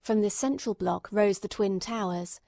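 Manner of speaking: plain reading voice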